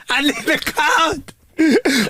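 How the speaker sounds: high, stretched-out comic plea; put-on desperate begging; mock desperate wail. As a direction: wail it slowly, hamming up the urgency. Acted desperate demand for toilet code.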